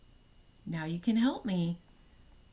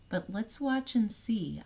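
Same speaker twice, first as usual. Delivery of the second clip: usual speaking voice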